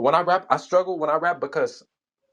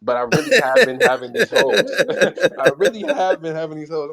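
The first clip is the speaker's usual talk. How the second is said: high, louder words through laughter; laughing while talking; 3 semitones higher, 7 dB louder than usual. Real, not acted. Rapper admitting the corny bar is true, laughing. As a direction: crack up while insisting it is true.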